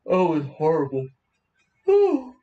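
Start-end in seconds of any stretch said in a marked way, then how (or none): 1.9-2.3 surprised. Real, not acted. Loud sudden exclamation.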